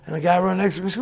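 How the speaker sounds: ordinary delivery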